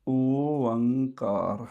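ਓਅੰਕਾਰ drawn out very slowly with a rising end; slow model pronunciation. Shown as a demonstration. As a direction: set ਓਅੰਕਾਰ down slowly as the reference. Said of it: "ਇਹ ਹੈ ਜੀ ਇਸ ਪਾਵਨ ਸ਼ਬਦ ਦਾ ਉਚਾਰਨ". (ਓਅੰਕਾਰ ਬਹੁਤ ਹੌਲੀ ਉਚਾਰਨ)

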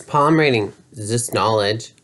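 ordinary talk, nothing marked

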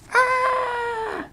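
very high startled cartoon shriek; scared shriek. Piggy shrieking in fright at the snake.

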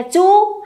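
"chu" with a plain back oo vowel; wrong vowel. Shown as a demonstration. Teacher shows class the misreading, "chu" with an oo vowel. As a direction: say "chu" as the mistaken oo reading. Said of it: "không được đọc là chu nhá".

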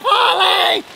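normal talking voice

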